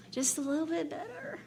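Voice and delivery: soft sigh then hushed words; relieved sigh. Retold or imitated. Opening the liquor store door, hushed relieved sigh. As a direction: breathe out the relief. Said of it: "it's like".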